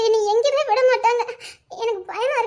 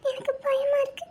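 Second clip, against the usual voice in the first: tearful; 3 semitones higher, 7 dB quieter than usual. Acted. Frightened girl pleading to go home.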